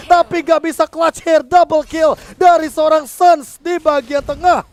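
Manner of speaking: fighting game announcer. Real, not acted. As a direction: scream the legendary play to the crowd. Co-caster erupting at legendary play.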